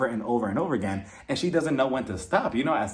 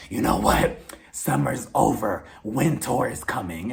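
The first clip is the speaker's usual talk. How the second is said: low, slow, drawn-out threat; mock ominous warning; 3 semitones lower than usual. [name] warning through Kenya's hotel door, mock ominous. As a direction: draw it out like a dramatic TV line.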